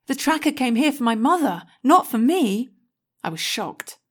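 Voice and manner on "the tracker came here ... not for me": alarmed. A narrator's reading. Realising danger to her mother.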